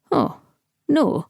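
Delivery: high sarcastic oh no; mock shock. A narrator's reading. Falcon mocking the empress, high and sarcastic. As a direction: feign surprise, high and mocking.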